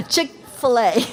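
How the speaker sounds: a light Southern twang on Chick-fil-A; mock Southern Chick-fil-A, laughing; Southern accent. Shown as a demonstration. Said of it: "I'm turning Southern".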